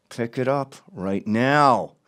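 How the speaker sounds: slow mob-boss threat; Tony Soprano voice. Retold or imitated. Man ordering dog owner to pick up mess, slow mob threat. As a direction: draw out each word like a mobster. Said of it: "my classic Tony Soprano".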